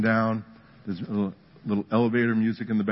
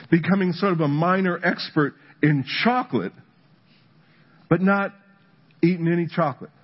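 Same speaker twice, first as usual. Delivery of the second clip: higher, rising preacher's fervor; preaching at full force; 7 semitones higher than usual. Acted. Pastor pressing his point to the church, fervent. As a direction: drive it home to the congregation.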